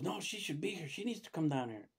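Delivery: retold insistence, starting high; mad nurse. Nurse demanding that the doctor come down, mildly mad. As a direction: imitate a nurse's annoyed demand. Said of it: "and the nurse got mad".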